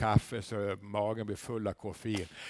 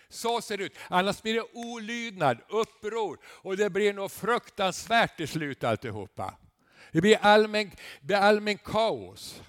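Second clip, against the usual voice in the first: loud, raised preacher's voice; preaching at full force; 7 semitones higher, 8 dB louder than usual. Acted. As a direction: thunder it from the pulpit. (predikar med full kraft)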